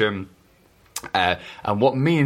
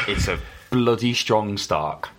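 ordinary talk

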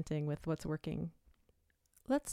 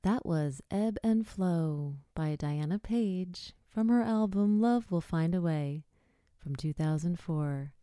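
classical music radio voice; 4 dB louder than usual. Shown as a demonstration. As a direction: announce it like an NPR classical station. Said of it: "that was my N P R, you know, classical music radio voice".

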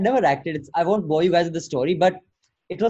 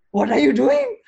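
raised, puzzled what are you doing; baffled director; 7 semitones higher, 5 dB louder than usual. Retold or imitated. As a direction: ask it in mock bewilderment. Director reacts, quoted in story.

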